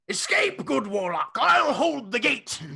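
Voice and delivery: high, loud gallant battle cry; hammed-up hero calling out over danger; heroic shout. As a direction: shout a brave farewell order.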